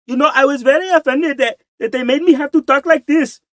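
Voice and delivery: high-pitched put-on Indian accent; mock Indian accent. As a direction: imitate an offended Indian actor's exaggerated accent. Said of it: "they're talking like".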